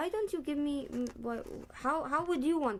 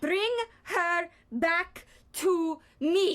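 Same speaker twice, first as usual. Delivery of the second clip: dramatic shout; 7 semitones higher, 7 dB louder, much slower than usual. Acted. Scene rerun, shouted line.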